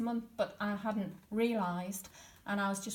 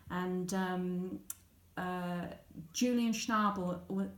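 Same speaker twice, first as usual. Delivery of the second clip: ordinary talk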